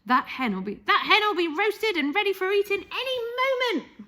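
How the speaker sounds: put-on gleeful old woman calling down; Grandma shouting. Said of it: "Grandma shouted".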